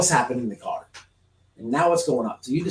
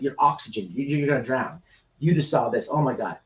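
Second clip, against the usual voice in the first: ordinary talk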